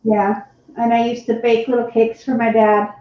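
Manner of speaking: normal talking voice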